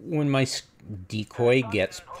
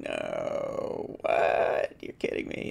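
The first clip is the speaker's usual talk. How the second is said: hushed, breathy mock incredulity; whispered disbelief; 13 semitones lower, much slower than usual. Gamer retelling his frustration, whispering. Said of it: "I was like".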